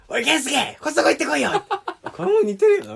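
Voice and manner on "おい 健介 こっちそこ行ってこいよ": higher, laughing shout of a coach; impression. Doing an impression requested by his friend, high and laughing. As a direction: bark the call out, half laughing. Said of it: "あれ、健介".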